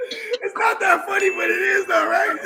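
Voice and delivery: high laughing voice. Real, not acted. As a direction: talk through the laughter. Laughing at own joke.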